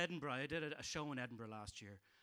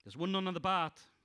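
louder stand-up telling; joke setup; 4 semitones higher, 7 dB louder than usual. Acted. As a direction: set up the gag.

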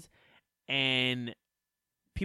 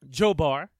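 loud; 10 dB louder than usual. Real, not acted. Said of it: "repeated nickname shout".

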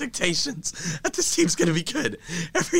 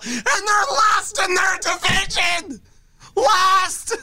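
hysterical laughing rant; 12 semitones higher than usual. Real, not acted. Fan losing it over his last-place team, high wailing rant. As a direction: howl it out, half laughing, half crying.

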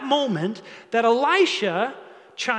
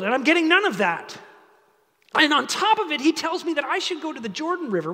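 higher, louder mock grievance; acted indignation; 6 semitones higher, 3 dB louder than usual. Naaman grumbles about being sent to the Jordan, acted indignation. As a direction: pile on the complaint, mildly outraged.

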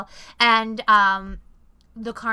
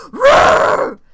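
a sudden, much higher, much louder monster roar; demon roar; 17 dB louder than usual. Retold or imitated. Retelling the car commercial's jump-scare demon, a monster roar. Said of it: "a demon fucking pops up and is like".

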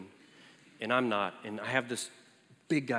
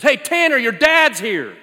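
mock Jeff shouting; 10 semitones higher, 14 dB louder, slower than usual. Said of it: "he goes".

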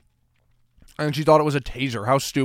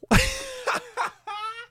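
squeaky, much higher burst of laughter; high-pitched laugh; 6 dB quieter than usual. Real, not acted. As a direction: cackle at your own joke. Laughs at his own jab.

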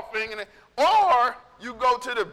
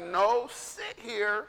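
stern self-talk; 3 semitones lower, slower than usual. Retold or imitated. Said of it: "I just like".

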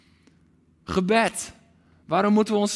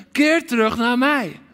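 heart cry; 4 semitones higher, 5 dB louder than usual. Acted. Preacher voicing God, calling his people back loudly. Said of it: "De roep van Gods hart".

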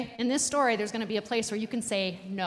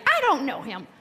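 loud, higher storybook anger; angry; 4 semitones higher, 8 dB louder than usual. A narrator's reading. Pastor tells children of Peter denying Jesus, angrily. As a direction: snap out an angry denial. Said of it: "Peter got angry".

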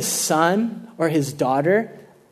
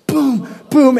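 punchy mimicked boom sounds; imitated bat thuds; 7 semitones higher, 5 dB louder than usual. Bat swung next to head.

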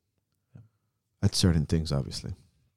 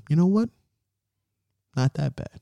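pleased surprise; 6 semitones higher, 3 dB quieter than usual. Retold or imitated. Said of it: "and go".

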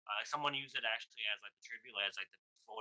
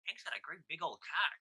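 higher-pitched imitation of an old-timey storyteller; vulgar old-timer; 6 semitones higher than usual. Comic remarking on a colleague's tape, lightly imitated vulgar old-timer. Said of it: "very vulgar, but also in an old-timey way".

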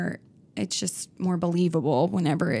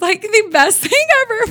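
laughing; 8 dB louder than usual. Real, not acted. Laughing at own seriousness.

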